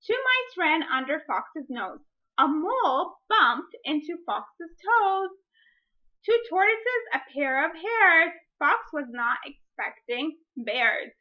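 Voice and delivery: put-on reading to children, lively, building up; picture-book narration, slowing on the bears; story reading. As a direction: read it like story time, with suspense. Reads picture book aloud.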